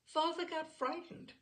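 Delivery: plain reading voice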